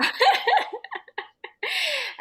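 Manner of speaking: loud high laugh; high laughter. Real, not acted. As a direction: burst out laughing. Laughing after the roar.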